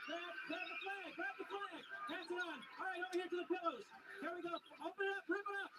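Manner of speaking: high, excited race calling; live play-by-play. Real, not acted. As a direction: urge the team on against the clock. Host calls the live race.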